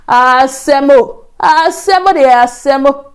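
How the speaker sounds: slow, loud drawn-out wail; loud cry of woe; dramatic lament. Retold or imitated. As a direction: stretch the words out in mock despair. Lamenting the trouble while pleading.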